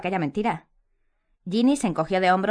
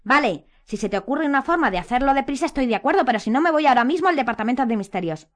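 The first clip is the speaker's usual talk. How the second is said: loud, high combative ultimatum; aggressive; 6 semitones higher, 5 dB louder than usual. A narrator's reading. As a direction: lay down terms forcefully. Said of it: "en tono agresivo a Hermione".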